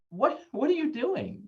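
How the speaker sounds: high, curious what are you doing; high puzzled question. Shown as a demonstration. Parent calmly asking a screaming child, curious and high. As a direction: ask it lightly, genuinely puzzled. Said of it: "that's the difference".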